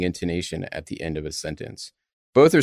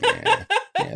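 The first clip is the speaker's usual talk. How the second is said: laughing. Real, not acted. Laughing agreement.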